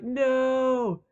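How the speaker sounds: high, loud, anguished imitated shout; hammed-up desperate no; mock Frodo cry. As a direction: cry out as Frodo watching Gandalf fall. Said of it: "And Frodo's like".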